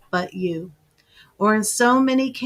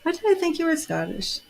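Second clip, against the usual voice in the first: surprised; 8 semitones higher, 4 dB quieter, faster than usual. Real, not acted. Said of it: "Oh, you're not Scottish".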